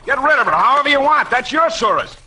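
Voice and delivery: high, fast and scornful, brushing her off; scornful outburst. Acted. Spade scoffs at the lawyer's old woman offer, high and fast.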